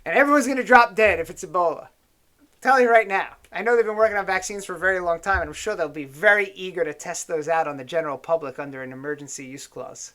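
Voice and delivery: ordinary delivery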